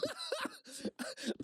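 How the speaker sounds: high, squealing laughter; helpless laugh. Real, not acted. Laughing at himself for checking with bar staff, helpless laugh. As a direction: dissolve into a long, high laugh. Cracks up at his own story.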